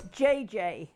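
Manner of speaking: ordinary delivery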